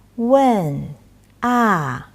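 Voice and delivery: "问" slow, falling sharply. Shown as a demonstration. Teacher showing the falling "问" meaning to ask. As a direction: let "问" and "ah" fall. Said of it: "Tones can distinguish meaning".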